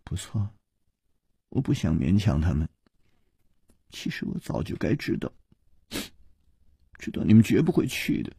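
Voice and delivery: soft, low, dejected murmur. A narrator's reading. Princess with head bowed accepting their refusal, hurt murmur. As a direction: murmur it as if to yourself. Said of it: "喃喃地说".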